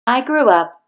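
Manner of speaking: ordinary delivery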